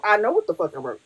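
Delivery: ordinary talk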